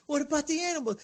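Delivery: sing-song mocking parody; mocking God. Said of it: "It's like".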